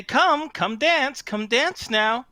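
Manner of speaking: high, slow coaxing fairy call; fairy invitation. Acted. Fairies calling the party to dance, high and coaxing. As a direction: lure them in like a teasing sprite. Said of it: "They say".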